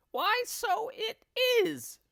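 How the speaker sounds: put-on high, slow old woman's voice; squeaky old crone, puzzled; Missus Twit, high. A narrator's reading. Wife answering her husband in a high, slow old crone voice. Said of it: "Missus Twit said".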